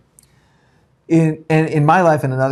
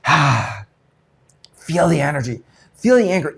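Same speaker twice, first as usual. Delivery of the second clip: right way to feel anger. Shown as a demonstration. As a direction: lean into it and let the anger build. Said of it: "Just be like".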